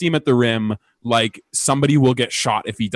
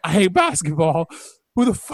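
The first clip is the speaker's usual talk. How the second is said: mock scream; 6 semitones higher than usual. Retelling a screaming fit at the game, mock scream. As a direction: wail it without the volume. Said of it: "I actually started screaming so much".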